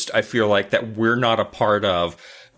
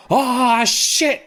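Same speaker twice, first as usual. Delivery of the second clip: mock startled yelp, much higher; 13 semitones higher, 4 dB louder than usual. [name] startled by a spider mid-serve, mock yelp. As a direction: jump at the spider, squealing high.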